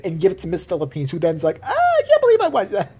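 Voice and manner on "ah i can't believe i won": very high, squealing disbelief; mock stunned winner. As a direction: shriek it like a pageant queen in shock. Mocking Miss Philippines' joy.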